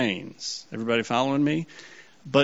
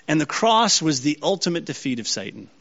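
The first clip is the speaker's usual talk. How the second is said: usual speaking voice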